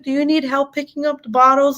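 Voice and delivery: put-on high, sweet little boy voice; eight-year-old son. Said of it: "my poor eight-year-old with his sweetheart".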